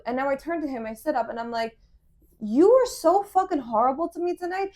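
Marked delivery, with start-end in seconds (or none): 2.4-4.7 high, loud retold reproach; re-enacted angry confrontation; indignant accusation. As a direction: lay into him, voice raised.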